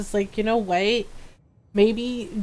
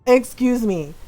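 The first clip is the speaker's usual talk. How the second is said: usual speaking voice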